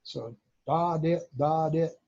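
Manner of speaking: spoken da and dit beeps imitating a telegraph key; voiced Morse code. Shown as a demonstration. Showing viewers what the paddle sends, mock Morse beeps. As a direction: sound out the dahs and dits like a key clicking. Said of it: "and get dits".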